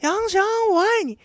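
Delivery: high-pitched mock declaration from upstairs; mock love shout. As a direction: imitate a girl yelling her love across a playground. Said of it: "她在操场上说".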